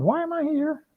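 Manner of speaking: high, soft whine; whiny voice. Acted. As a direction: whine it as a self-pitying complaint. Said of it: "That's my whiny voice".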